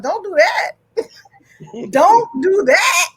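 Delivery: stern warning cracking into laughter, slowed; laughing cop warning. Retold or imitated. Cop warns the woman who pushed him, laughing through it. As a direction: try to play the cop but keep laughing.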